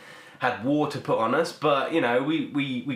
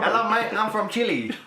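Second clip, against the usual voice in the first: man from Chile; 8 semitones higher than usual. Acted. Skit of meeting a Chilean.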